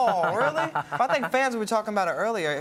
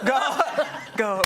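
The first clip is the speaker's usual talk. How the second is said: put-on higher, louder playful echo of his accented "Go"; imitating the guest's accent; 5 semitones higher, 4 dB louder than usual. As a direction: mimic his accent, amused. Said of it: "can you say it with that accent".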